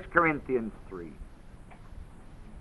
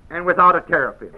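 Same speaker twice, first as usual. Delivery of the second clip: "Without" pressed, then quick; "Without" stressed, louder and brisk; 4 dB louder, faster than usual. Shown as a demonstration. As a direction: hit "Without" and rush the rest. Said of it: "That's how it's said".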